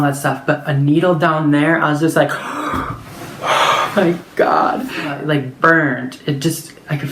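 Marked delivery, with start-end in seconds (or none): 2.3-2.9 gasp. Retold or imitated. Reacting to the needle. 3.4-4.9 slow, breathy whispered gasp of shock; stunned hushed oh my God; breathless whisper. Retold or imitated. Reacting to needle burn.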